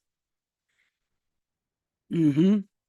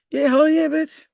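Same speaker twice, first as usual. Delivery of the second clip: higher, much louder cheer; excited; 9 semitones higher, 7 dB louder than usual. Real, not acted. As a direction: hype yourself up. Proud exclamation.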